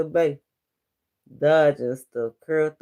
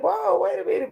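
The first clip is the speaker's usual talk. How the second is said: much higher, startled "whoa"; surprised; faster than usual. Retold or imitated. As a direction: yelp it as if caught off guard. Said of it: "I was like".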